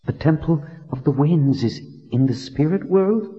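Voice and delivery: put-on a reverent young woman asking. A narrator's reading. Heroine voiced in story.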